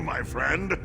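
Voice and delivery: ordinary talk, nothing marked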